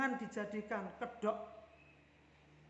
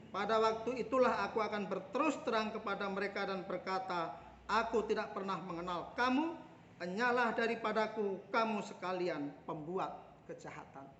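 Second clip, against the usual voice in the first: raised, declaiming Bible reading; solemn, ringing scripture read; 4 dB louder than usual. Acted. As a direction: read the verses out to the congregation with force. Preacher reads Matthew 7 aloud.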